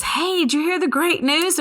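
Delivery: high, eager imitated phone greeting; excited news-breaking. Doctor phones with good news, retold.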